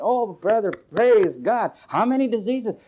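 loud, high mock amazement; impressed church crowd. Preacher mimics everybody praising the healed man, mock-awed. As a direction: gush like an impressed congregation. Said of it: "everybody said".

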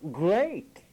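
GREAT hit hard and loud, imitating them; mock-emphatic GREAT, leaning hard on the opening sound; emphasized first syllable. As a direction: punch out GREAT as one loud mock exclamation. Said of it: "they emphasize the first syllable".

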